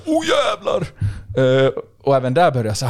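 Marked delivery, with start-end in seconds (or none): 0.1-0.9 much higher, astonished oh jävlar; retold thrill at seeing the trout; excited exclamation. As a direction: shout with amazement at first sight of the trout. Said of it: "jag bara".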